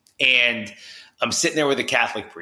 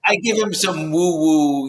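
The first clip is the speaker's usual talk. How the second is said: normal talking voice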